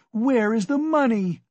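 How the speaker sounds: frantic wife's high-pitched outcry; crying out. A narrator's reading. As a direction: cry it out in a fury. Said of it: "she cried".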